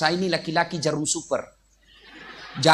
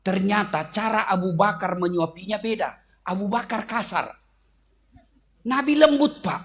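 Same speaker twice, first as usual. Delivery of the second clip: normal talking voice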